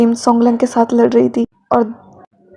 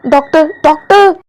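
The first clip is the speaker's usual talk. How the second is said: loud, higher panicked call; shouting for doctor; 4 semitones higher, 5 dB louder than usual. A narrator's reading. Wing yelling for a doctor at the hospital, loud panicked shout. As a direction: yell down the hospital hall for help. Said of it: "wing चिल्ला रहा था".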